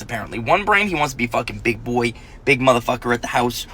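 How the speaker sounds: usual speaking voice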